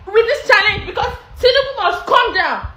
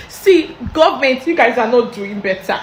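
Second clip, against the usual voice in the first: normal talking voice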